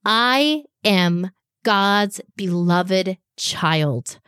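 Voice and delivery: very slow, each word set apart; slow, deliberate affirmation for children to repeat; slow demo affirmation. Shown as a demonstration. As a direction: say it slowly like a declaration. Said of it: "my challenge for you today is to say this".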